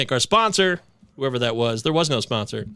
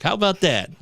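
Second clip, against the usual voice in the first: normal talking voice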